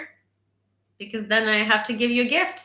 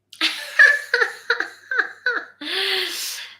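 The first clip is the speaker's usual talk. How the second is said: long, high-pitched real laugh; real high giggling fit; 11 semitones higher, 3 dB louder than usual. Real, not acted. Laughing at own retort.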